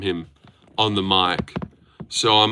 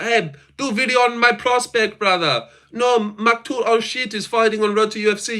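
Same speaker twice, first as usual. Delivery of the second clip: high mocking Indian-accent fan voice, pitched up; 9 semitones higher than usual. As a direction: parody a pushy Indian fan begging for a video. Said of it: "These fucking Indians don't leave me alone about".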